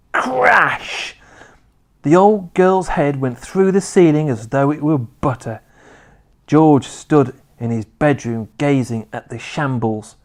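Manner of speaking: plain reading voice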